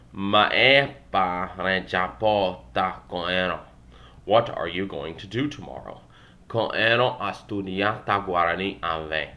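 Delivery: ordinary talk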